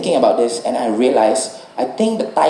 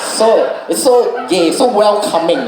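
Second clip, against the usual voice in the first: camp gushing; 7 semitones higher, slower than usual. Pretend. Gushing about the Thai accent.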